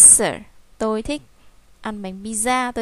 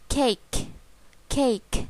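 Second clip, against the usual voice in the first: usual speaking voice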